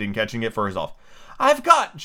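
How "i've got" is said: high, loud cry of triumph; triumphant. A narrator's reading. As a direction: crow it out in triumph. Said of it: "cried in a triumphant tone".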